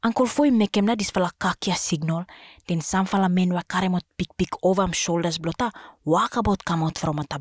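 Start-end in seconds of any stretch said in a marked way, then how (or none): none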